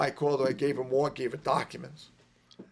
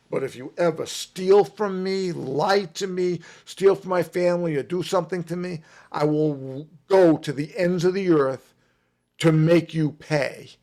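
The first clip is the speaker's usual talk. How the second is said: stern threat; 3 semitones higher, 8 dB louder than usual. Retold or imitated. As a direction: lay down the law, eye to eye. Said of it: "I looked him right in the eye. I said".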